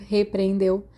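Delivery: usual speaking voice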